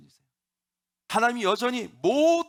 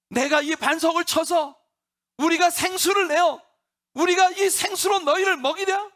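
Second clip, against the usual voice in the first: furious, higher and louder rebuke; angry outburst; 9 semitones higher, 6 dB louder than usual. Acted. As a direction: scold the people with exasperated rage. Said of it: "화를 벌컥 내면서".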